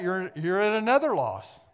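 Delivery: usual speaking voice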